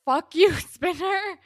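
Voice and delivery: high, loud, slow curse with a laugh; exasperated cursing. Real, not acted. Host telling off a TV character, loud laughing outrage. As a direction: curse him out, half laughing.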